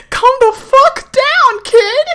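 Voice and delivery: high squealing laugh, then a laughing yell; laughing hard. Real, not acted. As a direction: crack up and shout through the laughter. Laughing at the game character.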